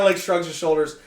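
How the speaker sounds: usual speaking voice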